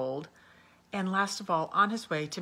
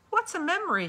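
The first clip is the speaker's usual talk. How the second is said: put-on high, loud eager boy's question; high curious little boy; eager child; 13 semitones higher, 6 dB louder than usual. A narrator's reading. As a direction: ask it like a curious small boy.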